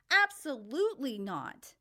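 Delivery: loud, sharp refusal; yelling. A narrator's reading. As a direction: shout it down firmly. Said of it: "he yelled".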